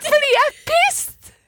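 very high, choked-up protest; tearful. Real, not acted. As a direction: try to keep telling it while crying. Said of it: "Du begynner å gråte".

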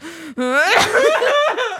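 loud held-in giggle; stifled laugh burst. Real, not acted.

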